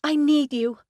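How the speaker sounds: high, strained voice near tears; barely holding back a sob; choked plea. A narrator's reading. Woman begging her friend to come, choked. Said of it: "Michelle choked out".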